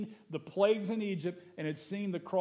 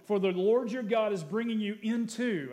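louder, raised proclaiming read; scripture reading; 4 semitones higher, 5 dB louder than usual. Acted. Pastor reads the promise of the land to the church, proclaiming. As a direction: lift the verse to the room.

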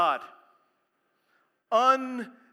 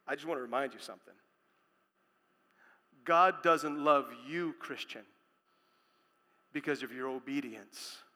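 soft, low, intimate reassurance; a quiet pastoral reminder; tender aside; 6 semitones lower, 6 dB quieter than usual. Real, not acted. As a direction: lean in and speak it gently.

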